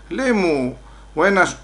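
ordinary talk, nothing marked

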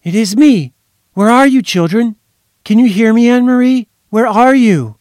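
high, loud urgent calling. A narrator's reading. Mother shouting into the phone for her lost children. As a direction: call down the line to reach her.

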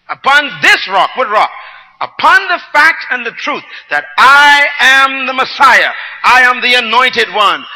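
loud, high, impassioned pulpit delivery; booming sermon voice voicing Christ's claim; full-force preaching. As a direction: preach it out to the whole church, building with fire. Preaching Christ's declaration loudly.

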